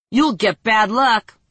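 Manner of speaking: slow, slurred and loud; drunken slur. Acted. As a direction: slur it sleepily.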